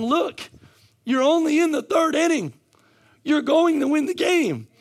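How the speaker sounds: high, loud preacher's proclamation; rallying shout. Retold or imitated. The Father encouraging his doubting children, rallying shout. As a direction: shout it like a coach rallying players. God voiced as rallying coach.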